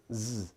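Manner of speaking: a held, buzzing z; isolated voiced z; buzzing Z sound. Shown as a demonstration. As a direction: let the z buzz on its own. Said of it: "hear a buzz in voiced sounds".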